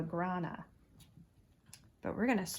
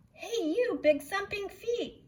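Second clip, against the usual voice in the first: shouted frog; 13 semitones higher than usual. A narrator's reading. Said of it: "shouted the wide mouth frog".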